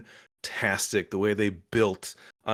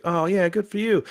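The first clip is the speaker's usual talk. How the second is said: high, louder retold approval; approving reaction; 9 semitones higher, 4 dB louder than usual. Fan reacting to a character's choice, high retold approval. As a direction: pitch it up as a viewer's reaction.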